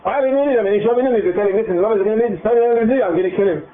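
the offended leader putting them in their place; indignant new leader. Acted.

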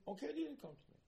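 Cain sulking. Retold or imitated. Cain answering God about the fire, high whine.

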